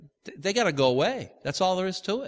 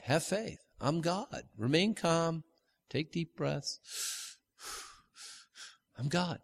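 softer, slow calming voice of God; 10 dB quieter, slower than usual. Pretend. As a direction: soothe the frightened as God would, softly.

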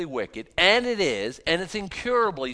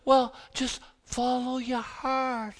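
put-on higher, slow, airy ditzy girl voice; 10 semitones higher, slower than usual. Teen girl giving dating advice, ditzy and dreamy. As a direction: play the naive girl, dreamy and unbothered. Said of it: "call her, uh, Ditzy".

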